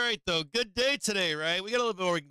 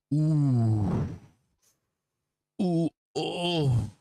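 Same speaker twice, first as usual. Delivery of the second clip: pained ooh; 3 semitones lower, 4 dB louder, much slower than usual. Real, not acted. Reacting to a price spike.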